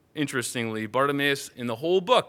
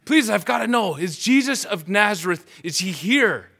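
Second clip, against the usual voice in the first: high, loud desperate begging; frantic pleading; 7 semitones higher, 8 dB louder than usual. Acted. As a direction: clutch his arm and beg to know. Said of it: "grabs hold of someone".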